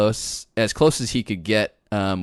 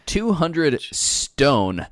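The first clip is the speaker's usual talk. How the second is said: slow and deliberate; 4 semitones higher, much slower than usual. Acted. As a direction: stretch each word out slowly. Quizmaster reads the question.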